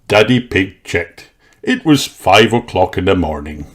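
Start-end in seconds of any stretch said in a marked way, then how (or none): none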